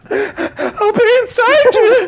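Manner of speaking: mock sobbing. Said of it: "He'd be like".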